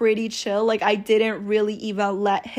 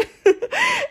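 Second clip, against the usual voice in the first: high-pitched burst of giggling; high giggle. Real, not acted. Laughing at her own teasing act, a high giggle. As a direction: squeal of laughter at herself.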